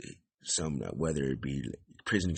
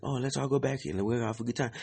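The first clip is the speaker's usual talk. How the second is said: innocent suggestion; 7 semitones higher than usual. Retold or imitated. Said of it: "I was just talking like".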